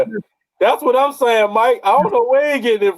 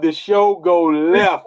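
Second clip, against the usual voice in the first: slow drawn-out; much slower than usual. Acted. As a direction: stretch out "the show go left" slowly. Drawn-out catchphrase repeat.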